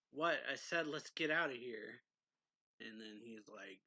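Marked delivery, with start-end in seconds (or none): 0.1-2.0 raised voice, louder and insistent; mock-exasperated outburst, hardest on the first word; retold exasperated shout. Cruel insisting they get out of here, retold shout. Retold argument about fleeing.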